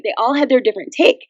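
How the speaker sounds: normal talking voice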